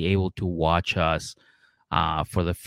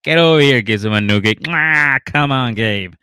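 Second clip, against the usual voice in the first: boisterous dad; 3 semitones higher, 6 dB louder than usual. Retold or imitated. Dad welcoming boyfriend at the table, loud and jolly.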